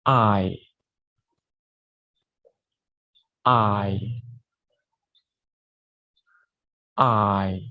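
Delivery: an isolated "I" for students to repeat; teacher modelling "I"; model the I sound. Shown as a demonstration. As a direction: say "I" as a clear a-to-i glide. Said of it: "Please repeat after me".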